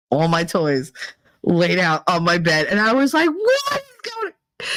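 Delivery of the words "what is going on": very high, drawn-out mock shock; retold shock. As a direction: stretch it out in horrified disbelief. Finding toys laid out on bed.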